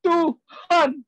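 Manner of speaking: high, drawn-out wailing prayer; mock crying. Believer crying to God over a small problem, mock wailing. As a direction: imitate a whiny tearful cry to God. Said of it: "sedikit ada masalah nangis".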